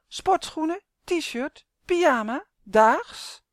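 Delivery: disdainful doll. Acted. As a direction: list them one by one with distaste. Doll lists clothes with disdain. (hoge poppenstem vol minachting)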